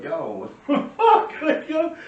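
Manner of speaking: amused disbelief at the snitch; laughing disbelief. Retold or imitated. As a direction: laugh out the outrage. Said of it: "we were like".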